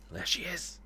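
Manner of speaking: breathless whisper of someone spotting a figure; hushed whisper. Acted. Gamer spots her and whispers in awe, whispered. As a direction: whisper it, afraid to be heard.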